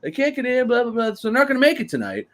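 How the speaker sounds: high, quick mocking retelling. Mimicking Sami Zayn's phone report in a high voice. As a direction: squeak it out fast, making fun. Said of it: "He's like".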